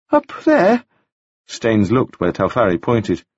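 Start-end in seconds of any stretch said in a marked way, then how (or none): 0.1-0.8 put-on much higher, bright boyish voice; high bright young gentleman. A narrator's reading. Wounded Staines greeting Taufari, high and bright. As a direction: lift it up high and bright like a dazed young toff. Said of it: "speaking in a voice that was high and very bright".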